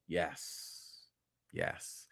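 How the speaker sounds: very low, soft yes, dropping to a whisper; hushed approval. Real, not acted. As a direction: savour it under your breath. Savouring a casting pick.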